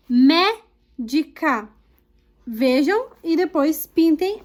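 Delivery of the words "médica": médica slow, in pieces, MÉ punched; drawn-out "médica" with a strong first syllable; stressed syllable demo. Shown as a demonstration. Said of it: "pinte a sílaba tônica".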